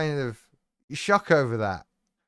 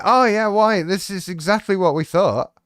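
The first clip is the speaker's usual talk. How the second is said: higher, louder sarcastic voice; sarcastic mock statement; 4 semitones higher, 6 dB louder than usual. Host mocking the foundation's fake reply, sarcastic.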